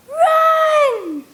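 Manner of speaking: very high, loud, drawn-out shriek. Acted. Girl shrieks to warn Rocky to flee, shrieking. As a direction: shriek his name with everything. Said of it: "I shriek so loudly".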